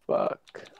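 ordinary talk

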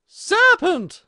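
very high, loud pigeon scream. A narrator's reading. Pigeon attacks Alice, screaming high and loud. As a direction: scream the accusation as an alarmed bird. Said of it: "screamed the pigeon".

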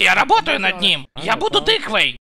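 usual speaking voice

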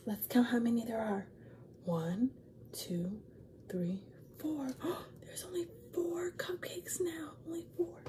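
soft whispered counting; hushed suspense. Acted. Reader whispering the count to listening kids. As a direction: whisper as if the thief could hear.

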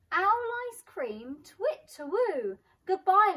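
high, loud, slow alarmed hooting; a startled owl's hoot; panicked hoot. Acted. Owl panics and flees.

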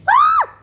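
short, very high loud shriek; frustrated scream. Real, not acted. Player stuck in the game, frustrated scream. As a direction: let out a piercing yelp. Failing at a hard game part.

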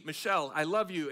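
ordinary talk, nothing marked

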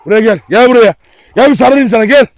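loud, insistent summons; calling out. Real, not acted. Calling the dog to come. (yüksek sesle çağırma)

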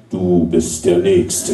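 very low, slow ominous voice; extremely deep bass voice. Acted. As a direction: drop deep and drag it out. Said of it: "mit tiefer Stimme sagen".

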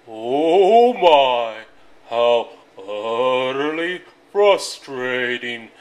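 loud, slow lowing cow voice; mooing cows. A narrator's reading. Cows complaining to rooster in mooing voice. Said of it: "mooed the cows".

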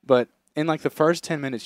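ordinary talk, nothing marked

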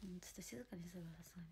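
soft, quick whisper; real whisper. Real, not acted. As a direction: drop to a hush so the neighbours can't hear.

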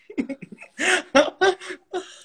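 high burst of laughter. Real, not acted. Guest laughing at his own joke about the relationship, in high bursting laughter.

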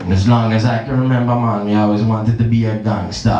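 low, mock Jamaican gangster narrator; a hint of a Jamaican Goodfellas voice; Jamaican accent. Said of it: "with a Jamaican accent".

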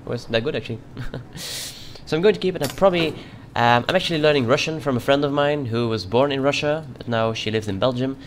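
put-on Russian accent all through the playthrough; a border guard's mock Russian accent. Youtuber playing a border inspector, in a Russian accent. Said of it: "I will be keeping my Russian accent".

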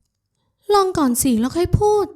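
high, drawn-out challenge; insistent woman character. A narrator's reading. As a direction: urge him in her high voice. Woman urges him to taste. (คะยั้นคะยอเสียงสูง ลากเสียง)

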